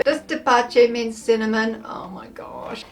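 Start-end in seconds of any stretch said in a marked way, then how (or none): 1.9-2.8 hushed whisper of dismay. Real, not acted. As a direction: breathe it out under your breath. Shocked aside about cinnamon.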